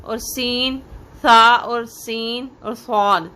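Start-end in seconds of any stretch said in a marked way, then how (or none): 1.2-3.3 सा और सीन और सौद spoken slowly; slow contrast. Shown as a demonstration. Teacher comparing three letters, slow. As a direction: lay the three side by side. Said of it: "तीनों में फ़र्क क्या है?".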